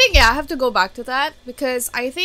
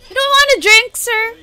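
much higher and louder, playfully posh offer; mock butler; 11 semitones higher, 8 dB louder than usual. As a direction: hand over an imagined drink like a waiter. Mock offering a drink to a character.

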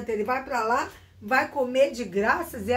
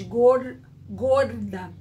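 Paulista "gorda" with a curled-back R; Paulista accent; 4 dB louder, much slower than usual. Shown as a demonstration. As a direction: demonstrate São Paulo's retroflex R in "gorda". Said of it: "O paulista já diz".

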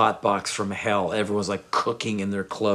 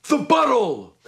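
imitated command; 12 semitones higher, 5 dB louder than usual. [name] demanding from his manager, loud imitated command. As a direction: call for it imperiously, voice pitched way up. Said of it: "looks at his manager guy, goes".

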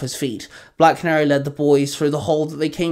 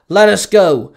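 loud, higher-pitched bark; barked order; 5 semitones higher, 8 dB louder, slower than usual. A narrator's reading. Chained young hero demands his release from the villain, barking. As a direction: snap it out forcefully. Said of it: "Robin barked".

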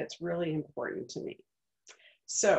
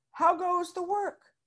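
higher farmer call; farmer's call; 11 semitones higher than usual. Acted. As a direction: echo the farmer's lazy call. Duck expects farmer's call.

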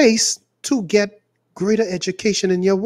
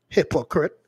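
muttered accusation; 5 semitones lower, 5 dB quieter than usual. Acted. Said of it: "repeated accusation".

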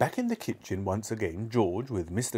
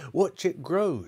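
higher, excited call; mild shout; 8 semitones higher, slower than usual. A narrator's reading. Mister Cranky watching the hen, mild excited shout. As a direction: call out without full volume. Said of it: "shouted Mister Cranky".

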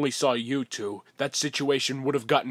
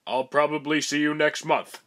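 usual speaking voice

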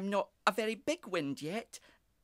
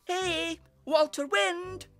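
high, loud, drawn-out call; little breeze shouting; 10 dB louder, much slower than usual. A narrator's reading. Breeze calls his big brother in the sky, shouting. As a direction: holler up to someone far away. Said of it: "shouted the little breeze as loudly as he could".

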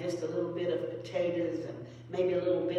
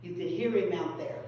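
ordinary delivery

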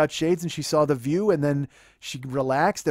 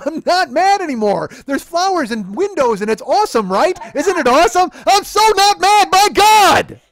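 high, loud protest building to a yell; exasperated shouting; 11 semitones higher, 12 dB louder than usual. Retold or imitated. Boyfriend insisting to his girlfriend he isn't mad, yelling. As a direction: shout it, climbing louder and higher to the end.

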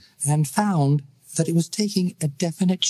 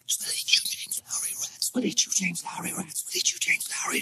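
soft, ghostly whisper of the taunt; eerie whisper; 4 semitones higher, 8 dB quieter than usual. A narrator's reading. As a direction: breathe the words like a fading echo. Echoes fade into whispers.

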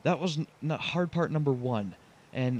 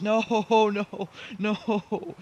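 high, loud, drawn-out laughing despair; exasperated cry breaking into laughter; despairing wail; 7 semitones higher, 6 dB louder, much slower than usual. Real, not acted. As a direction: wail helplessly as it all goes wrong. Truck fails again, driver wails.

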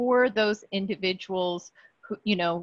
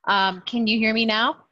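raised, louder check of being heard; speaking up; 3 semitones higher, 5 dB louder than usual. Real, not acted. Caller checking the meeting can hear her, speaking up. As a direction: lift the voice to be heard. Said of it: "can you speak a little louder".

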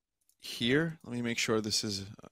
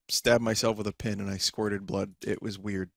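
ordinary talk

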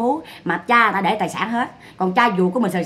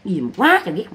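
ordinary talk, nothing marked

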